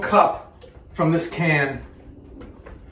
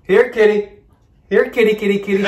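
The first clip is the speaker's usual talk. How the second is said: louder, higher call for a cat; 4 semitones higher, 4 dB louder than usual. Pretend. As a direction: call the kitty sweetly. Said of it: "only kidding".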